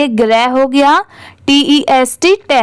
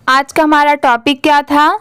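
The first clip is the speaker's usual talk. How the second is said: ordinary talk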